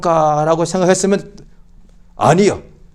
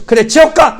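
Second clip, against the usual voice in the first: mock angry retort; 9 semitones higher, 4 dB louder than usual. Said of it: "얼굴 벌겋게 가지고".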